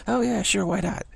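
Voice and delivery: casual shrug, why not; laid-back kid. Retold or imitated.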